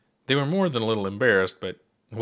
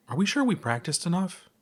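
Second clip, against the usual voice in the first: nervous high question; 8 semitones higher than usual. Acted. Nervous question before broadcast.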